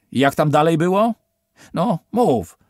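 high, loud, pushy voice; impatient demand. A narrator's reading. Officer demanding Steven finish the phrase, impatient loud. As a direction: force him to answer now.

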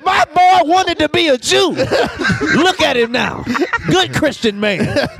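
high-pitched mock church testimony; hammed-up congregation address, slowing at the end; testifying to church. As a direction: play a proud dad testifying, with laughs. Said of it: "He testified to the church".

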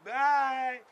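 usual speaking voice

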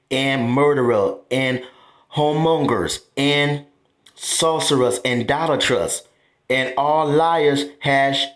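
slow verse reading with deliberate gaps; slow, clear. Shown as a demonstration. Preacher reads the warning verse to listeners, slowly and clearly. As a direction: spell out each item of the list slowly. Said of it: "I'ma say slow".

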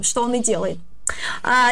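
ordinary talk, nothing marked

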